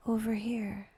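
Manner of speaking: usual speaking voice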